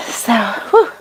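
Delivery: sigh, then blow out a breath; catching the breath mid-thought; puffed, with an audible exhale. Real, not acted. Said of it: "stop hyperventilating".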